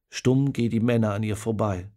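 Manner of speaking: usual speaking voice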